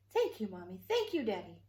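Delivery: a touch of bright, cheerful gratitude; happy thanks. Acted. Betty thanking her parents on her birthday, lightly happy. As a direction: thank them like a delighted little bear. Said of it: "Betty Bear answered happily".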